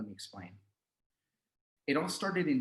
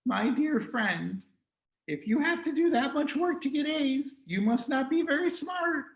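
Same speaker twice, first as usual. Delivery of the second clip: gloating; 14 semitones higher, 3 dB louder than usual. Acted. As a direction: rub it in with condescension. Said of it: "He would gloat".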